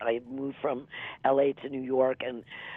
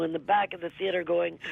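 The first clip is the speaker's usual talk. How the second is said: usual speaking voice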